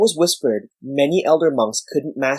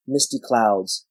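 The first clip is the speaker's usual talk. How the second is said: usual speaking voice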